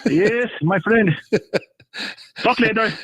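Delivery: put-on slow drawn-out my friend, accented; Middle Eastern accent. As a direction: stretch out my friend, foreign accent. Caller's sign-off.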